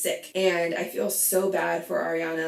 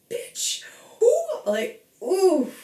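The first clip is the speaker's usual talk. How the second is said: shrieking high "who?" then a loud "ooh"; high, loud outraged squeal; 12 semitones higher, 4 dB louder, much slower than usual. Real, not acted. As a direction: squeal it in disbelief. Outraged reaction to Rachel.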